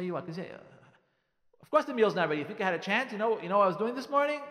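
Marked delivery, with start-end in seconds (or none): 1.6-4.4 defensive. Acted. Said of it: "you start defending yourself".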